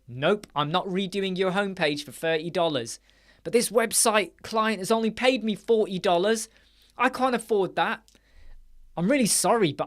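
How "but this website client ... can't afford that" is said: higher, lightly whiny client voice; mock pleading client. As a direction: play a cheapskate client begging for a discount. Client pleading poverty, mocked.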